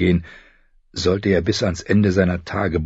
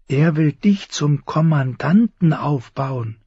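higher, gently eager voice; earnest Sam; 7 semitones higher than usual. Acted. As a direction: persuade a friend kindly and earnestly.

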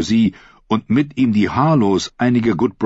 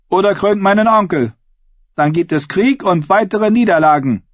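high-pitched, forceful oratory to a hall; rally the assembly in a raised, ringing pitch; defiant female claimant; 13 semitones higher, 5 dB louder than usual. Acted. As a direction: challenge the crowd like a contender for the crown. Asha addresses the assembly.

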